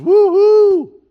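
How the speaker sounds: loud high whoop of joy; a wild celebratory woo-hoo; rejoicing whoop. Retold or imitated. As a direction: cheer like a delighted disciple.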